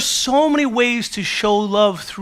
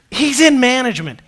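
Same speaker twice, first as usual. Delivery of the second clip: "in" punched higher and louder; stress on in; 5 semitones higher, 5 dB louder than usual. Real, not acted. Preacher contrasting friend's job with his own, stressing "in". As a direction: lean hard on the word "in", friend versus me.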